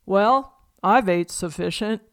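Pa after supper in a hammed-up Southern voice; a folksy Southern twang, put on for a laugh; Southern accent. Pa tells Ma he has eaten, in a mock Southern accent. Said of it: "in their strongest Southern accent".

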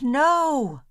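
high, loud scream of refusal; a prince screaming in panic. A narrator's reading. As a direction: shriek it in horror. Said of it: "The prince screamed".

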